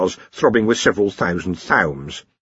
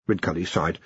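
plain reading voice

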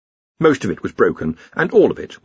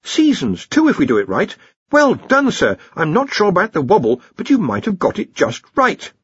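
higher-pitched, eager praise; excited young wizard; 9 semitones higher than usual. A narrator's reading. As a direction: bubble over with a new idea.

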